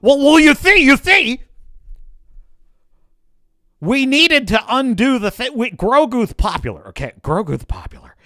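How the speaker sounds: high, loud, hammed-up excuse-making exec; mock smug executive. Mocking the studio boss fumbling an excuse, high and loud.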